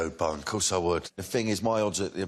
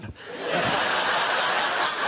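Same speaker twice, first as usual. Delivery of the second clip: breathy burst of laughter; laughing; 9 semitones higher than usual. Real, not acted. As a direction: laugh hard at the punchline. Laughs after daughter punchline.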